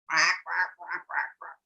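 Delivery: low mock quacking; silly duck quacking; duck quacks.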